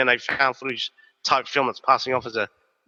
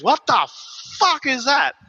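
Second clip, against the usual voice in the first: shocked exclamation; 11 semitones higher than usual. Retold or imitated. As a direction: blurt it out, stunned by what he sees. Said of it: "his mate goes".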